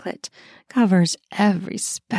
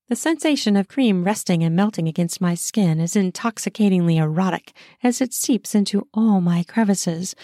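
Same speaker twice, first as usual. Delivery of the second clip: ordinary delivery, nothing marked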